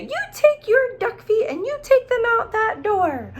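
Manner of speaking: high, loud scolding mom voice; mother scolding. Acted. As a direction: shriek it like an angry mother. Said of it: "And she would say".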